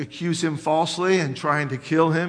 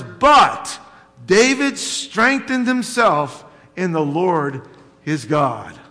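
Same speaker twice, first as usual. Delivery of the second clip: louder, emphatic reading of the verse; scripture reading; 5 dB louder than usual. Acted. Pastor reading the verse to his congregation, proclaiming.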